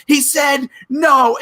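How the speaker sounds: high, drawn-out mock shout; mock insistence. Friend relaying the refusal to the wife, loud mock insistence. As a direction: stretch it out in hammed-up exasperation. Acted quarrel over the glasses.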